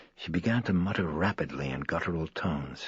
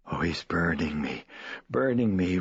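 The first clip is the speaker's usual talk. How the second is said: ordinary delivery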